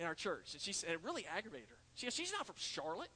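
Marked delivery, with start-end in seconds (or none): 2.1-3.1 imitated Gaffney accent, pitched higher. Church lady aggravated at the actress's claim, Gaffney accent. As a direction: lightly mimic a Gaffney, South Carolina accent. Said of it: "she said it in her Gaffney accent".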